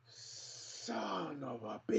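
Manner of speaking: slow, drawn-out dismay; frustrated groan. Real, not acted. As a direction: groan it out long. Goal ruled offside.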